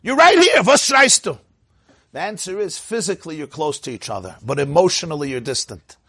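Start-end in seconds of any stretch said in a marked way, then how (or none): 0.0-1.4 high, loud exasperated outburst; acted marital shouting, pitched way up; mock yelling. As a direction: yell it at someone standing right beside you. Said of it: "They're yelling".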